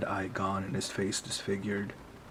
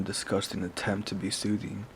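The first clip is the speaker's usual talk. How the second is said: ordinary delivery, nothing marked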